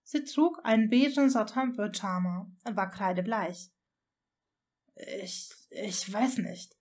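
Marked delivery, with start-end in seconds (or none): 5.2-6.7 slow, softer, halting reply; confused stammer. A narrator's reading. Draco answering just after waking, confused stammer. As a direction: stammer it slowly, at a loss. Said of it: "stammelte er verwirrt".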